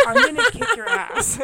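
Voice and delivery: playful high-pitched threat, half laughing; mock threat, laughing. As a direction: yell it through a laugh at your sister. Mock threat after scare, laughing.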